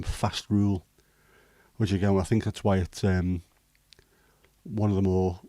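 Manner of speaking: ordinary talk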